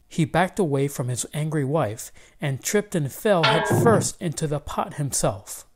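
ordinary delivery

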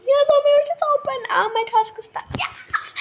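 high excited yes; excited squeal. Real, not acted. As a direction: squeal with joy at the win. Door opens in game.

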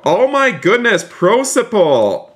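high-pitched, amazed exclamation; astonished delight. Real, not acted. Streamer reacting to a viewer's surprise donation, astonished. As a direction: exclaim high, drawing out the name.

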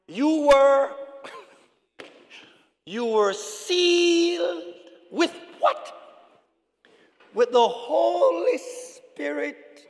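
loud, high, long-held scripture words; slow reading. Real, not acted. Preacher reading the sealing verse to church, slow reading. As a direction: stretch the verse out for the congregation. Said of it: "I'm gonna read it slowly".

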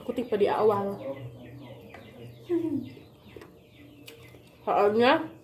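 chewing while talking; talking around food; mouth full. Real, not acted. Seen 0: left speaker bites meatball, chews and talks throughout.

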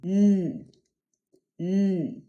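Mm as a sustained nasal "nnn". Shown as a demonstration. Teacher demonstrates the nasal consonant, hummed "nnn". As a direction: hum Mm through the nose as N. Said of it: "N jest dźwiękiem nosowym".

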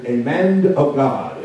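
put-on drawn out, slow, old-time preaching; old church preacher style. Shown as a demonstration. Preacher to congregation, old-time church drawl. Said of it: "Like they say in the, in the old church".

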